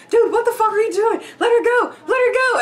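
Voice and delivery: high, loud shout ordering him to stop. Retold or imitated. Sober friends shouting at the drunk man to release her. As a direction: yell at your drunk friend to let go. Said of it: "a little more sober were like".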